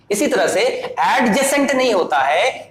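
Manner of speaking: normal talking voice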